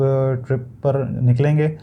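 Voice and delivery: usual speaking voice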